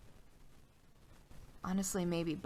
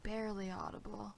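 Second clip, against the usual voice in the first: soft, hushed game master scene setting; eerie hushed narration; 8 dB quieter than usual. A narrator's reading. Game master hushing the table into an eerie scene, softly. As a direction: draw the players into a creeping dread, quietly.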